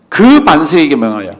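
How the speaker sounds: "그"를 강하게 짚어 읽기; "그"에 힘을 주고 나머지는 낮게; stress on 그. Shown as a demonstration. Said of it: "그냥 반석이 아니고 그 반석입니다". ("그"를 강조하는 낭독)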